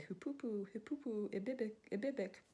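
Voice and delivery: light sing-song hoopoe call; bird call. Acted. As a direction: chirp it like the bird. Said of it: "and the bird said".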